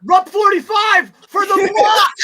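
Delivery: slow, loud game-show shout; shouted play call. Pretend. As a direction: call it out like a quarterback.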